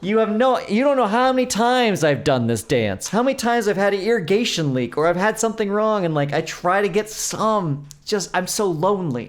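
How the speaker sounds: high, loud, slowing exasperated plea to a clerk; exasperated rant. Retold or imitated. To the store clerk, a high, loud exasperated rant. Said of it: "And I was like".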